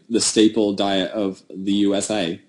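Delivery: ordinary talk, nothing marked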